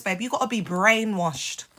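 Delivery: normal talking voice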